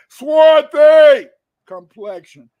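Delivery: swarthy drawn out very slowly, high and loud; emphatic stretch. Real, not acted. Lecturer reading a runaway ad, swarthy stretched slow, high and loud. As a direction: lean hard on swarthy, stretching it.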